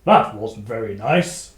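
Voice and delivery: gruff dog warrior. Acted. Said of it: "take his war, warhound".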